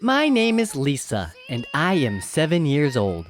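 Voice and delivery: gentle storybook read-aloud; reading to children. A narrator's reading. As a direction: read it like a bedtime story for kids. Reads Lisa's first-person story.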